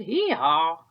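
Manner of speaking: loud braying hee-haw; loud donkey bray. Acted. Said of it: "No donkey said".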